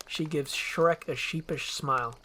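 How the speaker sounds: plain reading voice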